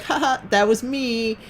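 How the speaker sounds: put-on slow, drawn-out high girly voice; mock a girl tittering, long high vowels; mocked giggly girl. Girl owning up to last game, high giggly mock voice. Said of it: "She's like".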